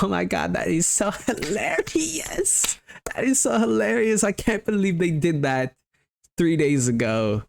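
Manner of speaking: high, breathy mock amazement; hammed-up high-pitched gossiping voice; reality show gusher. Couple gossiping on a reality show bench, mock-amazed high gushing.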